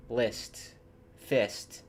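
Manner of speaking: ordinary talk, nothing marked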